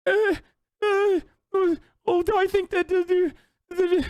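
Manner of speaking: hammed-up imitation of a panicky teen; mock anxious kid. Streamer mocking the anxious kid from the flag debate, high stammering voice. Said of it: "where the kid is like".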